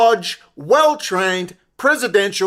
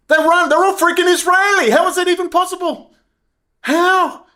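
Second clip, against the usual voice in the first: higher, louder indignant outburst; outraged disbelief; 9 semitones higher, 3 dB louder than usual. Real, not acted. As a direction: rail in disbelief. Incredulous outraged rant peak.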